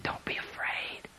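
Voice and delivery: barely audible, reverent whisper. Retold or imitated. Jesus reassures fallen John and John answers, whispered. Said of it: "he whispered to me".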